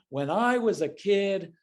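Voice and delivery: high mock lecture; hammed-up when-I-was-a-kid voice; lecturing parent. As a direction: parody an old-timer lecturing. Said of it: "there's no lecturing".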